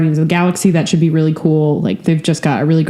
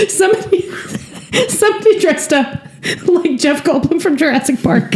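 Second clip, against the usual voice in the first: laughing; 10 semitones higher than usual. Real, not acted. Laughing while suggesting costume.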